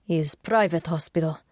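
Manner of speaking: lightly voiced Russian man; Russian accent. Sasha's Russian-accented reply.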